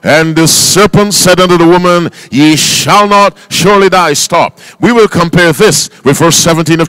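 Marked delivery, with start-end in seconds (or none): 0.0-4.1 louder and higher, every word clear; clear reading. Shown as a demonstration. Preacher asks the church to read the verse clearly, loud. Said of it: "clearly now".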